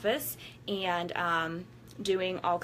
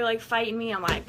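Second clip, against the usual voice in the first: ordinary talk, nothing marked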